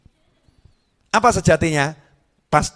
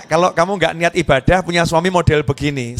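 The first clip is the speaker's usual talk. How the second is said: usual speaking voice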